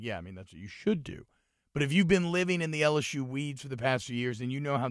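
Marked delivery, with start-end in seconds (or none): 0.0-1.3 low, soft, dismissive imitation; mock an unimpressed pundit, voice dropped; unimpressed shrug. Voicing unimpressed national media.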